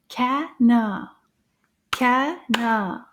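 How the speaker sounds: CANARD in two firm, separated beats; clapped syllables. Shown as a demonstration. Teacher clapping out "canard" with children, syllable by syllable. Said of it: "we'll clap them together".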